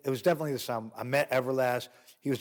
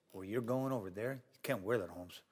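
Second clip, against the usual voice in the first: much softer, lower voice; hushed low warning from B-Real; talked real low; 4 semitones lower, 9 dB quieter than usual. Retold or imitated. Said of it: "he talked real low back then".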